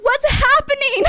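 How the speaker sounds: high, loud burst of disbelief; real thrilled shriek of a question; overwhelmed excitement. Real, not acted. Streamer swamped by new follows, overwhelmed and loud.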